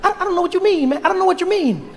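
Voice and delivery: very high, hurried stammer; too-fast young voice. Acted. Barack is puzzled by Mandela's criticism, high and hurried. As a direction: protest in a squeaky, rushed voice. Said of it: "You are speaking so fast".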